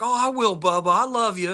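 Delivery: a hint of a dying dad saying goodbye; frail dad's voice. Dad telling his son he loves him, lightly voiced.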